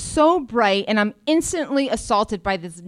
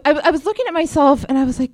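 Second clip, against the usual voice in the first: ordinary talk, nothing marked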